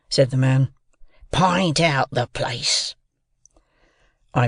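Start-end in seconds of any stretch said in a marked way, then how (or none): 1.3-3.0 slow, drawn-out convict's voice; menacing convict. A narrator's reading. Convict questions a seized boy, slow and menacing. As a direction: demand it slowly, as a threatening rough man.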